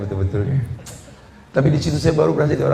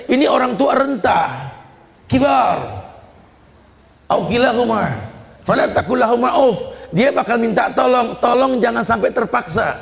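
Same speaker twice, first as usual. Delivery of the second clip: raised, high impassioned sermon voice; fervent preaching; 8 semitones higher than usual. Acted. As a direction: preach it hard to the crowd. Preaching at full force.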